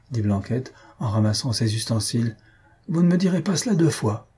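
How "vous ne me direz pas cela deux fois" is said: high, indignant parting line; offended retort. A narrator's reading. Clockmaker packing up to leave, offended. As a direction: take offence, pitch raised.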